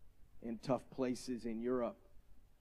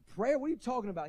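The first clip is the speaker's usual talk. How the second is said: incredulous; 10 semitones higher, 4 dB louder, faster than usual. Retold or imitated. Mocking the prayerless attitude.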